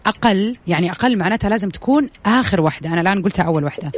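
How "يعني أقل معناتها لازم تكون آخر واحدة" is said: normal talking voice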